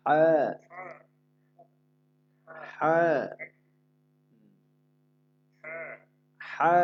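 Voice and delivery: হা breathed out gently, no pressure from the throat; an easy, light হা; soft unpressed ha. Shown as a demonstration. Teacher modelling the soft হা without pressure. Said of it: "আপনি চাপ দিয়ে উচ্চারণ করবেন না".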